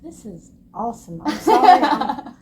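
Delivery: bursting into embarrassed laughter; laughing. Real, not acted. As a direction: laugh through the apology. Embarrassed laughter after crying.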